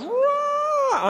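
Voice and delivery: high-pitched comic shriek; mock scream of terror; girlish scream. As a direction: let out a loud falsetto yelp. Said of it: "I'm screaming like a girl".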